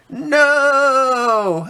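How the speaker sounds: high piercing scream of no. Acted. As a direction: cry out in terror, very high. Character falls screaming.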